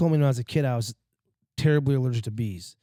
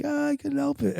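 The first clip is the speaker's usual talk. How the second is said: sheepish excuse; 12 semitones higher than usual. Retold or imitated.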